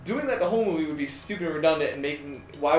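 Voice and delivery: ordinary talk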